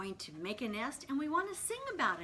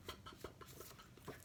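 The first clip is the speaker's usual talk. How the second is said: soft voiceless P pops; tiny puffs speeding up; popcorn popping; 15 dB quieter, much faster than usual. Shown as a demonstration. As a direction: pop the lips like corn popping. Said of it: "Sounds like popcorn".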